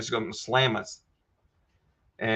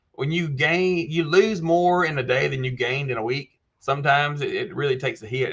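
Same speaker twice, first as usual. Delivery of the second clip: normal talking voice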